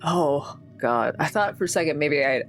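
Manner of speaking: ordinary delivery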